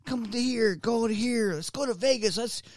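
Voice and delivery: lightly mocking high, soft invite. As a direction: mimic friends' breezy invites. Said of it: "and some other people".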